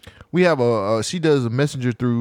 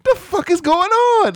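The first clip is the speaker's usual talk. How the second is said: surprised; 4 dB louder, slower than usual. Retold or imitated. Retold reaction to storming.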